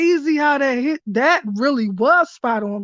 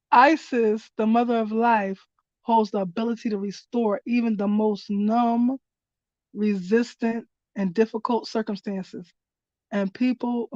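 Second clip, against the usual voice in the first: card reading voice; 2 semitones lower, 3 dB quieter than usual. Acted. Reader shares the card's message with viewers, in a calm reading voice. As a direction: read the guidance aloud like scripture.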